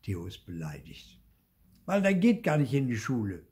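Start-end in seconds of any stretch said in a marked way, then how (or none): 1.9-3.5 grumbling. Acted. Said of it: "mault Theo".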